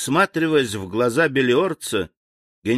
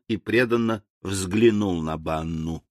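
plain reading voice

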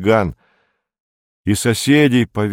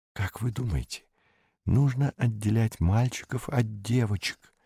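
hushed, tremulous half-whisper; trembling whisper; 2 semitones higher, 8 dB quieter than usual. A narrator's reading. Beatrice asks about separating boys from girls, trembling whisper. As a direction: ask it in a very soft, trembling whisper. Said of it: "дрожащим шёпотом спросила Беатриса".

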